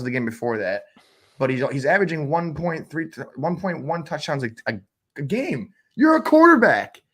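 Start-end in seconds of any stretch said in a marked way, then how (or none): 5.9-7.0 much higher and louder, fed-up yell; exasperated outburst. Real, not acted. Host venting at Russell Wilson, exasperated higher and louder. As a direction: blow up at the quarterback in disbelief. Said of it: "as you can tell, it kinda gets me".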